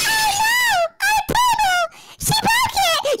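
high squealing panicked table; mock table voice. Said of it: "that shit said".

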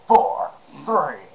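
slow gruff countdown; gruff soldier voice counting; Solid Snake voice. Shown as a demonstration. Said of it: "I'm going to start doing that".

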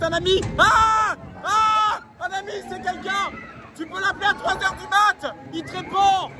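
high-pitched yelling over the ride; shouting on a ride. Real, not acted. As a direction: shout through the thrill of the ride. Cris sur le manège. (crier sur le manège)

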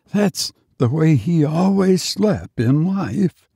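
ordinary delivery

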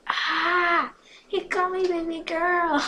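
high, loud swooning singsong; giddy squeal. A narrator's reading. Girl thrilled the boy called her baby girl, giddy squeal. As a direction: squeal it, drawing out the words in delight.

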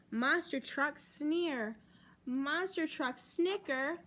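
ordinary delivery, nothing marked

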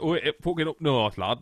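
a light mock northern English accent. Host mimicking a Derby northern accent while swearing, light northern accent. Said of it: "the accent sounds northern".

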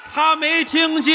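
loud and slow. Real, not acted. Repeating to a partner who keeps asking what, loud and slow. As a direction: say it loud and slowly for someone who didn't hear. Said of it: "你声音太高了".

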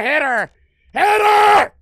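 high, loud frantic yell, building; panicked shouting. Real, not acted. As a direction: scream it in desperate panic.

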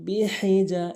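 "বিহিজা" with strong mid-throat ha; big ha. Shown as a demonstration. Teacher modelling the verse, "বিহিজা" with big throat ha. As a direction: recite "বিহিজা" with pharyngeal ha. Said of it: "বড় হা এনে উচ্চারণ করবেন".

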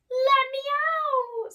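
high, loud scream. Acted. Scrooge pushed into the flaming grave, screaming. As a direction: scream in panic. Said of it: "screamed Scrooge".

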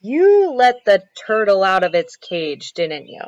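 stern accusation. Acted. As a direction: confront the children with what they did. Father accuses the children.

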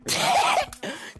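sudden unvoiced laugh; short, breaking laugh; burst of laughter. Real, not acted. Reacts to another player's mockery.

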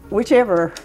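normal talking voice

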